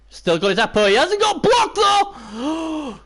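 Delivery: high and loud with relief; excited. Real, not acted. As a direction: cheer as the danger passes. Tense moment, the player survives.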